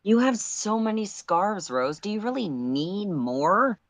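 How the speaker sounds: lightly voiced teasing pal; teasing friend.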